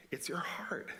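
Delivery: hushed whisper on heart. Acted. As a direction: drop to a whisper to land the point. Quiet climax of the point.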